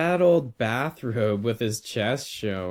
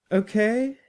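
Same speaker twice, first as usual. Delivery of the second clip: put-on high, gentle questioning boy; coaxing boy; 11 semitones higher than usual. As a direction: ask it high, seeking his agreement. Boy checks on the teacher.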